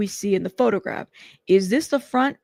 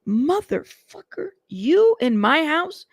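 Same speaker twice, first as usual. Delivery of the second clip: slow, higher demand in disbelief; angry; 6 semitones higher, slower than usual. Retold or imitated. [name] on the phone confronting [name], angry demand. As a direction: hit him with it, drawn out and suspicious.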